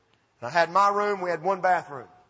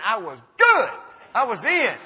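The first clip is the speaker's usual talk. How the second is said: loud, punched exclamation; exultant raised voice; triumphant; 4 semitones higher, 8 dB louder than usual. Real, not acted. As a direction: belt out the victory. Climax of getting into college.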